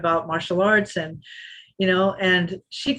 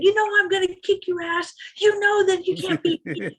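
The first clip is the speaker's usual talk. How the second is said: high, mocking sing-song taunt; taunting rival; 12 semitones higher than usual. Rival jeering at the champion walking away, high mocking taunt. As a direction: mimic her jeering as they walk off. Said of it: "she's saying".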